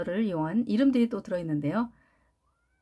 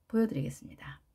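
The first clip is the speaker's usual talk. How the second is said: 1 whispered aside; 6 semitones lower, 5 dB quieter, faster than usual. Real, not acted. Teacher trails into whisper.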